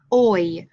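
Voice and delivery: "oi" said slowly and clearly, the mouth gliding from o to i; diphthong sound demo. Shown as a demonstration. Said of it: "So you can see my mouth changed".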